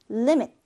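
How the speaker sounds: "limit" with the first syllable stressed. Shown as a demonstration. Teacher showing where stress falls, "limit" stressed up front. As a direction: hit the opening syllable of "limit", leave the second weak. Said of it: "앞 음절에 강세가 옵니다".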